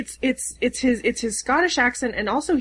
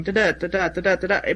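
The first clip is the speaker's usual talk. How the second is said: ping pong rhythm; 3 semitones lower than usual. Shown as a demonstration. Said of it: "kind of like a ping pong".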